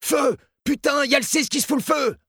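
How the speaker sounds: very high-pitched screamed fire alert; screamed alarm. A narrator's reading. Guard at the control screens spots a cell fire, screaming the alarm. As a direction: scream it out in urgent panic. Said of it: "et hurla".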